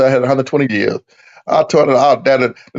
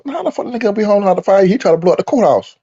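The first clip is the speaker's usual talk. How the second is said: ordinary talk, nothing marked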